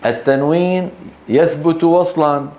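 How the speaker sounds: ordinary talk, nothing marked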